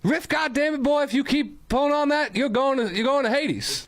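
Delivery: high-pitched hollering Southern preacher; shrill Southern twang, way up high; Southern accent. Acted. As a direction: yell it like a fire-and-brimstone Southern preacher. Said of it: "a southern accent or something?".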